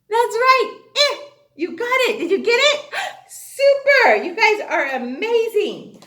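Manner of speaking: high and loud gushing praise with a gasp; excited praise. Acted. Teacher cheers her students' right answer, high and thrilled. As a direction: cheer them on like they won.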